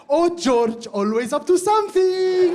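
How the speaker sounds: high, loud gushing over George; gushing mom. Retold or imitated. Mom gushing about George, high and loud.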